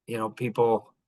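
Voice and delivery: ordinary talk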